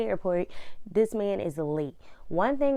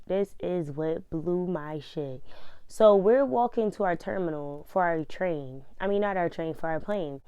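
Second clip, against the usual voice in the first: usual speaking voice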